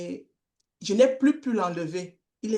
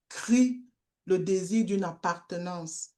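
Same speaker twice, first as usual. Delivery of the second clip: ordinary talk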